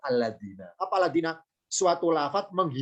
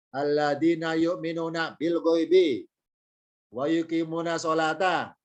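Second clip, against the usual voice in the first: slow, measured recitation of the verse; Quran recitation. Acted. Teacher recites the verse for the class, slow and measured. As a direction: draw out each Arabic word slowly.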